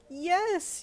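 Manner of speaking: an ecstatic shouted yes; screaming yes. Acted. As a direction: burst out with it. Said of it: "you screamed".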